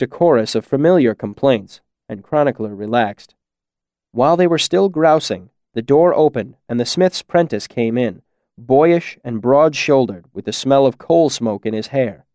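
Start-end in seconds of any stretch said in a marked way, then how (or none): none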